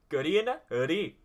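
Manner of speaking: hammed-up Indian accent; a quick jokey Indian accent. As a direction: toss off a mock Indian accent. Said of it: "I just find the Indian accent funny".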